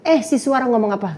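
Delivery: ordinary talk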